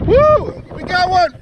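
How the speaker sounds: high, loud whoop of joy; excited shout. Real, not acted. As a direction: cheer out loud at the catch. Fish finally on the line.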